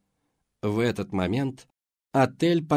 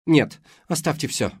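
urgent shout; 6 semitones higher, 6 dB louder than usual. A narrator's reading. Kelly urgently stops her.

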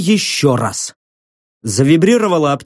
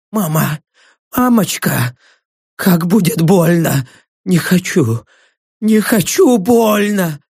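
whimpered plea swelling to a slow cry; frightened pleading. Acted. Dying prisoner calls for his mother, terrified. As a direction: beg in dread, rising at the end.

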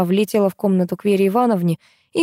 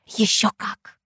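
hushed, voiceless confiding whisper; 3 semitones higher, much slower than usual. A narrator's reading. Asya confides in Katya in the corridor, whispering. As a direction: whisper it like a secret. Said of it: "зашептала Ася".

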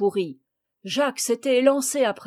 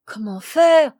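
loud, dismayed cry; raised, higher-pitched exclamation; exclaiming; 4 semitones higher, 9 dB louder than usual. A narrator's reading. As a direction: cry out, at a loss. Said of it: "s'écriait-on".

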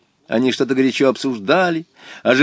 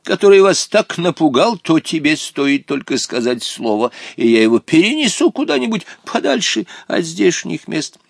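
courtly Hottabych; 10 semitones higher than usual. A narrator's reading. Old companion offering to remove the stubborn boy, courtly. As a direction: offer help with ceremonious courtesy.

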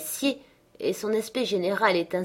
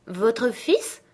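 higher-pitched, hesitant question; doubtful; 5 semitones higher, 3 dB louder than usual. A narrator's reading. Katy unsure who the young man is, doubtful question. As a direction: ask it doubtfully, voice raised. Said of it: "d'un air de doute".

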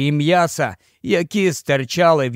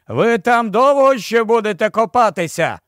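higher-pitched call, moderately raised; 7 semitones higher than usual. A narrator's reading. Captain hurries the men in the well, calling out. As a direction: call down impatiently, short of a shout. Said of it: "гукнув капітан".

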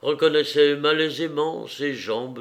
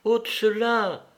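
put-on high, drawn-out little boy's voice; a small boy's request, slow and high; insistent child; 10 semitones higher, slower than usual. A narrator's reading. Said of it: "dit-il, lui enlevant son chapeau". (voix d'enfant aiguë et traînante)

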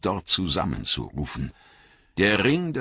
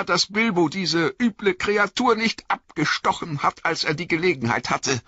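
put-on much higher, pleading young hobbit voice; 14 semitones higher than usual. A narrator's reading. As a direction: beg your old friend for help, scared. Frodo's bitter wish.

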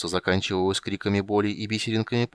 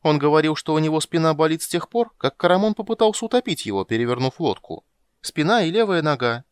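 higher, louder, chattering kender; кендер Тас, взволнованный рассказчик; 8 semitones higher, 6 dB louder than usual. Acted. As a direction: tell it eagerly in a lifted, lively voice. (взволнованный болтливый кендер)

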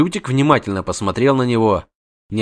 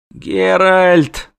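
moaning; 8 semitones higher, 3 dB louder than usual. Acted. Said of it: "простонал Лютик".

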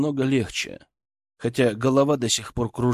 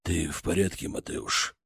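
low quiet father; 6 semitones lower, 7 dB quieter than usual. Acted. Father voiced.

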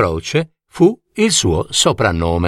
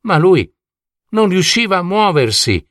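plain reading voice

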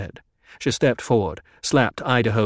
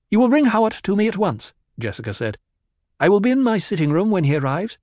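higher, louder, fast order; fast command; 9 semitones higher, 4 dB louder, faster than usual. A narrator's reading. Jessica ordering guards to fetch Hawat, fast and loud. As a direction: rattle off the command.

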